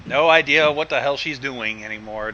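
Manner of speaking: ordinary delivery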